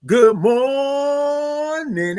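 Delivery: very slow, high stretched "Good morning"; signature greeting. Shown as a demonstration. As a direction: hold "Good morning" out high like a call to the room. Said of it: "we like to say good morning".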